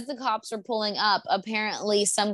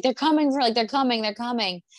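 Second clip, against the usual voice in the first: imitating a frantic warning, pitched up at first; mock panic; 3 semitones higher than usual. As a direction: act out a mildly panicked man repeating his alarm. Retold panicking man in lake.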